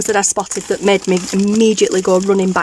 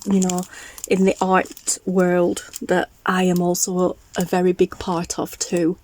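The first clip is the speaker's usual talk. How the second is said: ordinary talk, nothing marked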